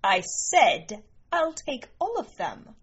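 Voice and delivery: high, emphatic repeat of an order; insistent command. Acted. Princess repeats her order to leopard men, insistent and high.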